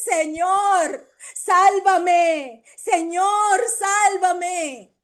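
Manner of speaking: high, loud plea to be saved; crying out for help. Retold or imitated. Peter sinking, crying out to the Lord, loud and pleading. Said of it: "y gritó".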